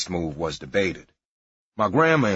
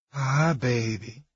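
soft seductive purr; 4 semitones higher, slower than usual. Retold or imitated. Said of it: "I purred in the soft, seductive voice".

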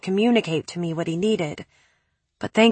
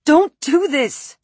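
much higher, slow, pleading shout; 8 semitones higher, 4 dB louder, slower than usual. A narrator's reading. Narrator shouting at Preppy to stop, desperate. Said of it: "I shouted".

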